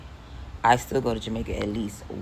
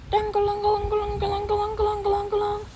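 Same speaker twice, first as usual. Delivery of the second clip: high, rapid singsong clanging; cowbell imitation; faster than usual. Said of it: "it sound like a cowbell".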